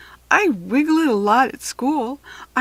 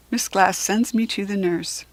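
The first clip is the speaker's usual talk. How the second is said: plain reading voice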